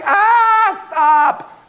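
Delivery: mock yell. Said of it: "he's like".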